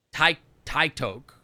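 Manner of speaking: TikTok said slowly with long I and O, like "tike-toke"; mock mispronunciation. Shown as a demonstration. Streamer mocking claims they say TikTok weird, deliberately mispronounced. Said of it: "What do you mean I say TikTok weird?".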